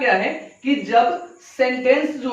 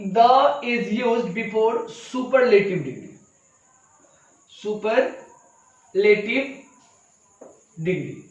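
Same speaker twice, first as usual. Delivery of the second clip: slow dictation; much slower than usual. Shown as a demonstration. Teacher dictating rule while writing.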